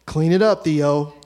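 loud mock order, copying dad; bossy order. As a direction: bark it like the boss of the house. Older brother echoing dad's order.

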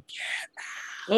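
soft, breathy ghost whisper; haunting voice. Retold or imitated. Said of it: "a ghostly voice going".

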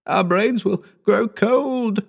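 high, slow dread-filled moan; drawn out in despair. A narrator's reading. Howard moaning his fear to the narrator, slow and high. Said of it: "he moaned".

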